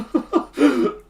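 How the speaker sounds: a big burst of laughter; loud laugh. Real, not acted. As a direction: laugh out loud, high and hard. Laughs at own remark.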